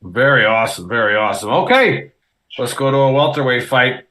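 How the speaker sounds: ordinary talk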